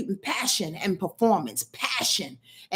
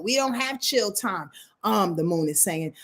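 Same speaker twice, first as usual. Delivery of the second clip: ordinary talk, nothing marked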